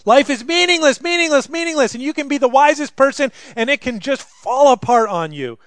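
loud, high lament of meaninglessness; impassioned lament. Acted. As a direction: proclaim the emptiness of it all. Ecclesiastes voiced with passion.

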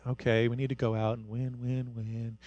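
low, soft, deliberately lifeless rallying; quiet mock pep talk. Shown as a demonstration. Bad coach sending the team out to win, quiet. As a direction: give a coach's speech with no energy at all. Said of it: "it's not this quiet".

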